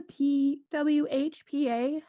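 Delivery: deliberate letter-by-letter emphasis; incredibly slowly. Real, not acted. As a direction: spell each letter out with exaggerated care. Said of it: "gonna say it incredibly slowly".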